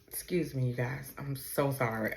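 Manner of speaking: soft, low, real tearful apology. Real, not acted. Grieving mother excuses herself for crying on camera, tearful. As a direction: apologize quietly through tears. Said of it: "not to be crying on camera".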